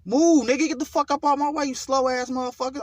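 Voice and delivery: furious shout from behind the wheel; road rage shout. Retold or imitated. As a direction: bellow at the cars in your way. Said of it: "and I'm going, like, eighty".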